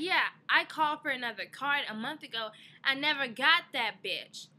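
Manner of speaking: loud, high indignant reply; irritated caller fighting the bank; angry retort. Retold or imitated. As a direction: push back hard at the bank. Arguing with Wells Fargo.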